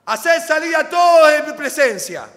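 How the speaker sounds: a booming, high shout of command; José crying out. Acted. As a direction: roar it out like a man who finally breaks. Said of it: "perdonen que voy a hacerlo así, me alejo del micrófono".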